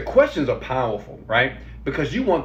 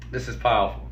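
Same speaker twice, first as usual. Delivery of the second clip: ordinary delivery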